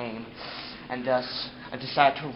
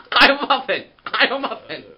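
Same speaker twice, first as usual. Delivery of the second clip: screaming; 8 semitones higher, 8 dB louder than usual. Retold or imitated.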